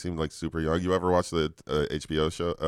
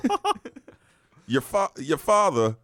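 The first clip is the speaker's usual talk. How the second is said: high, halting mocking voice; 6 semitones higher than usual. Mocking a friend's voice.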